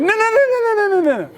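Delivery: very high, rattled-off string of noes; mock panic. Dad stopping son on the wrong pedal, panicky high. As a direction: imitate an alarmed dad, voice shooting up.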